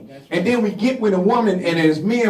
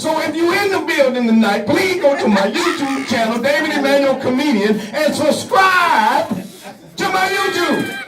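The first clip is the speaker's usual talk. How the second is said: poem; 8 semitones higher than usual. Acted. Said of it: "That's my poem".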